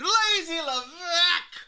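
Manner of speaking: loud, high drawn-out nickname cry; mocking cry. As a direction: holler the nickname high and long.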